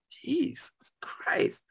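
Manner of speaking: slow whispered Jesus Christ under the breath; exasperated whisper. Real, not acted. Vlogger whispers in disbelief at the fuss. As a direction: breathe it out, fed up. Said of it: "exasperated aside".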